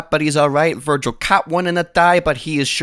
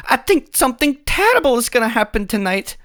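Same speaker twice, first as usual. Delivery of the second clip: high, breathless foreboding; mock dramatic lady; 10 semitones higher than usual. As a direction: ham up a worried warning. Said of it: "She's like".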